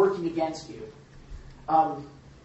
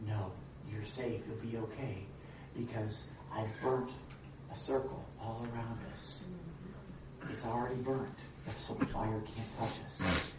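much softer, lower, comforting father; reassuring dad; 5 semitones lower, 12 dB quieter than usual. Retold or imitated. Father calming his scared daughter inside the burnt circle, soft and reassuring. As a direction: hush a scared child so she feels safe.

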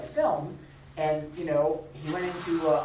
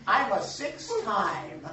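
slow, drawn-out mock brag, rising louder; boastful inner voice; 7 semitones higher, 8 dB louder, much slower than usual. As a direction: savor every word of the title. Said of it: "I'm thinking".

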